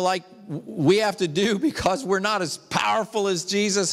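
normal talking voice

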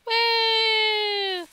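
long, high-pitched "wooo"; high squealed woo. Acted. Player squeals at the game.

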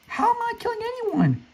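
squeaky falsetto mocking a victim; high mock whine. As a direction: put on a shrill cartoon voice of the player he killed.

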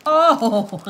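loud, startled happy cry; real gleeful exclamation; delighted surprise. Real, not acted. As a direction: burst out with a big pleased oh. Finds surprise in the package.

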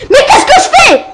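a shrieking laugh at his own mess-up; exasperated laughing. Real, not acted. Frustrated at failing the level.

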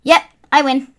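high, loud victory cheering; ecstatic win. Real, not acted. Gamer screaming with joy after winning the match. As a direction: celebrate the win with squeals.

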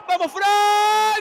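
slow, very loud urging cry; shouting encouragement. Real, not acted. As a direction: yell him toward goal. Urging the striker on.

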